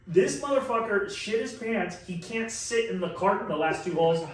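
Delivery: ordinary delivery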